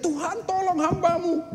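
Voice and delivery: high pleading call to God; desperate cry. Acted. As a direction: cry out again for rescue. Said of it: "Terus dia teriak". (orang saleh berseru minta tolong)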